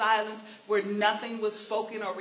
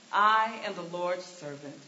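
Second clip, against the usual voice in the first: sincere Mary; 6 semitones lower than usual. Pretend. Mary's answer, said twice.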